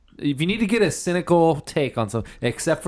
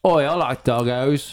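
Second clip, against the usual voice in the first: put-on Australian accent. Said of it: "an Australian accent".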